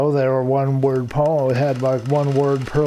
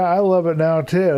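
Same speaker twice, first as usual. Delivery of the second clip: ordinary talk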